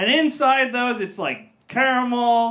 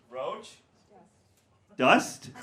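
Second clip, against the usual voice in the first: usual speaking voice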